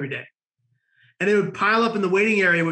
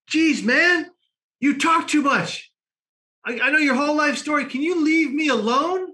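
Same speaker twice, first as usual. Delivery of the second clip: put-on higher, exasperated kid voice; a fed-up teenager finally speaking up; mock annoyed teen; 9 semitones higher than usual. Catatonic kid finally snaps at him.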